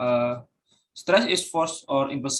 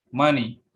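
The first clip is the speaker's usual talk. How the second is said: "money" stressed on MON, falling after; first-syllable stress; 3 dB louder than usual. Shown as a demonstration. Student presenter giving a noun example, "money" stressed on MON. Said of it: "stress on the first syllable".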